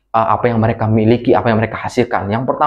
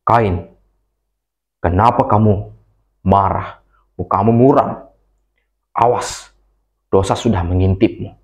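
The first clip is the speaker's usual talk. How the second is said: low, calm, grave warning; God's warning voice; 4 semitones lower than usual. A narrator's reading. God warns Cain. (Tuhan menegur Kain dengan suara rendah dan tenang)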